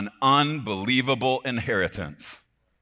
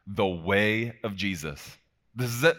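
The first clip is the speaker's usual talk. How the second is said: normal talking voice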